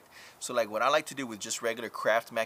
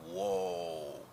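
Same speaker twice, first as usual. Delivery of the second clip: awed whoa; 6 semitones lower, 4 dB quieter than usual. Retold or imitated. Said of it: "seriously shocked and amazed".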